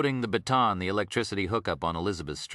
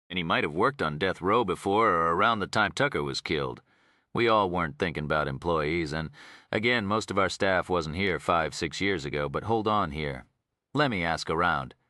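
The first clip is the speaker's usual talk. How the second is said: Texas warden. Acted. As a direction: talk like an easygoing Texas official.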